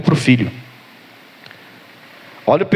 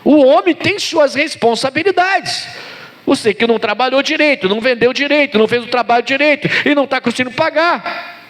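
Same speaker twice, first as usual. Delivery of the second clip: high, driving sermon rebuke; full-force preaching; 11 semitones higher than usual. Real, not acted. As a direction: preach in a high-pitched relentless cascade, ending phrases emphatically.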